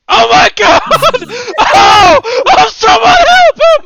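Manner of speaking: panicked high screaming; man down shout. Acted. Judge sees man collapse.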